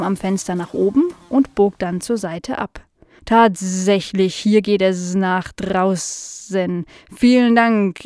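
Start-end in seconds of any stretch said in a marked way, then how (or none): 3.2-8.0 slow housefly with drawn-out buzzing s sounds; curious housefly. Acted. Fly meeting Nala for the first time, slow and buzzy. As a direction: stretch the words like a dopey, curious fly.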